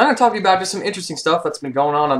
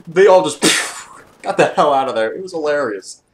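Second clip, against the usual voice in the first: laughing; 3 semitones higher, 4 dB louder than usual. Real, not acted. Laughing at fleeing team.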